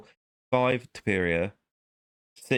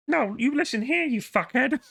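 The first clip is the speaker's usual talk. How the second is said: much higher mock-stern Peterson, laughing; Jordan Peterson impression. Peterson impression telling off an opponent, hammed up. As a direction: scold the imagined opponent in a put-on voice. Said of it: "I probably sounded nothing like Jordan Peterson".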